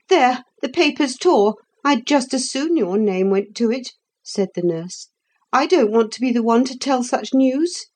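a hint of the fretful nurse. A narrator's reading. Nurse voiced in story.

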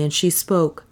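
ordinary delivery, nothing marked

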